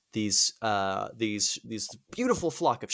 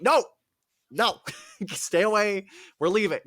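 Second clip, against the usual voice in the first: higher-pitched, hurried-to-leave mock panic; alarmed refusal; 7 semitones higher, 4 dB louder than usual. Odysseus refusing the islanders' flowers, mock alarm. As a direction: back off in comic alarm.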